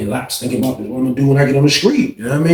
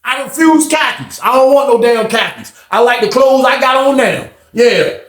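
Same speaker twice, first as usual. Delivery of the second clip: stubborn refusal; 12 semitones higher, 3 dB louder than usual. Retold or imitated. Inmate refusing khakis, stubborn.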